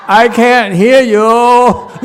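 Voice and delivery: loud, high, drawn-out call. Real, not acted. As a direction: shout it slowly, stretching the words. Calling for more volume from the group.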